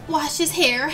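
usual speaking voice

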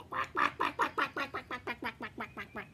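little ducks quacking, fading out; duck quacks. Retold or imitated. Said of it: "They say".

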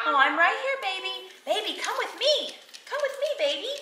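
high pretend mommy cooing. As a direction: play mommy to a baby, high and sweet. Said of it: "Okay, I'll be the mommy".

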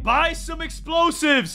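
high, drawn-out yell; high urgent shout; urgent calling out. Real, not acted. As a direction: holler the order, stretching the words. Calling for help in game.

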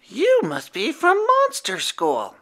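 high gentle werewolf; Howler's voice. A narrator's reading. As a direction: kind young werewolf greeting. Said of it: "said Howler".

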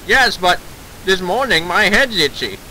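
rueful classic Hollywood mid-Atlantic voice; mid-Atlantic accent. Acted. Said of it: "whip out that mid-Atlantic accent".

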